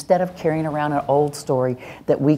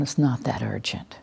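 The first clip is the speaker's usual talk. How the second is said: whispered aside; 4 semitones lower, 7 dB quieter than usual. Real, not acted. Calming self aside.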